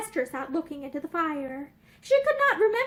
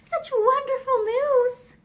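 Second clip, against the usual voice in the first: tremulous; 8 semitones higher, 3 dB louder, slower than usual. A narrator's reading. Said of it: "she said tremulously".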